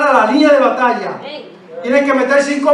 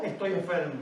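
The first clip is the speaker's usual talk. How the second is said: hushed answer; 4 semitones lower, 17 dB quieter than usual. Shown as a demonstration. Quiet falling answer to the setup.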